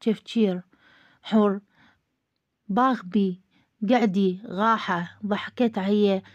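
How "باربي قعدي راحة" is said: باغبي and غاحة with a throaty gh for every r, slowed; child-like gh for r in باغبي and غاحة. Acted. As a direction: lisp the r into a gh sound, slowly. Hawr mispronounces r as gh.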